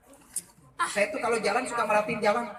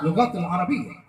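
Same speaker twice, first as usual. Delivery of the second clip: lower, laughing mock Arabic; mock Arabic phrase; 7 semitones lower than usual. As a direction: intone it like pious Arabic, with a laugh. Jokey Arabic again.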